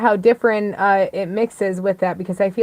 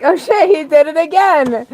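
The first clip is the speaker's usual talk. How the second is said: higher, louder excited outburst; excited reaction; 8 semitones higher, 7 dB louder than usual. Retold or imitated. Recalling her reaction to hearing his track, excited. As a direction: blurt it out in delighted surprise.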